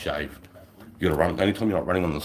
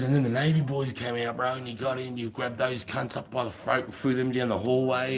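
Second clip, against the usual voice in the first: mate's voice; 7 semitones higher than usual. Retold or imitated. Mate recounting the night.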